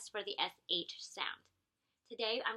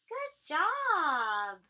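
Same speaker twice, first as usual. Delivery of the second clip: praise; 7 semitones higher, 8 dB louder than usual. Real, not acted. Praising child after success.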